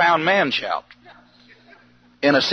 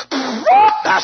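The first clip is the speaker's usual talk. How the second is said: drawn-out shout. Retold or imitated. Friend shouts at gas station.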